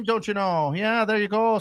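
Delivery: jokey Scottish-Irish brogue; ad-lib as a Scottish leprechaun; mock Scottish accent. Host ad-libs around the quote, mock Scottish accent. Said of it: "read it in a Scottish accent".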